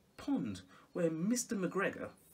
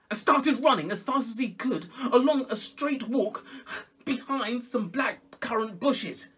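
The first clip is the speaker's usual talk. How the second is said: louder, racing urgency; excited chase; 8 semitones higher, 9 dB louder than usual. A narrator's reading. Storyteller gets loud as Peter runs. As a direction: burst into the chase.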